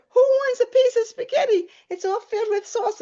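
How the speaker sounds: high, loud playful offer to the table; giddy. Retold or imitated. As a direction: call it out high in hammed-up giddiness. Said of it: "I started laughing".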